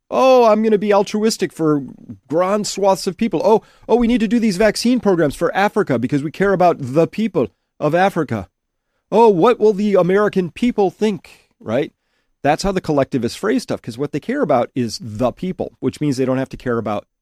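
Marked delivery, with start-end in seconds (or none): 0.1-11.5 mock altruist. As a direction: sneer it like a preening philanthropist. Mocking effective altruists' voice.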